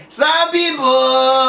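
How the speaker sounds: slow drawn-out jeering shout; taunting call. Retold or imitated.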